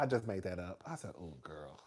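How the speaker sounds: low, very soft mock admission; hushed retold comeback after the outburst; deflated aside. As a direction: drop suddenly to a low murmur.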